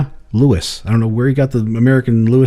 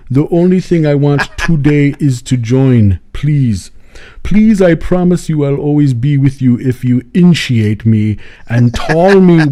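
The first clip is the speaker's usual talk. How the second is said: put-on louder, slow, begging African accent; imitating an African accent; 2 semitones higher, 6 dB louder, slower than usual. Zambian man pleading to worship the devil, begging African accent. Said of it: "But if I do this, then it's him".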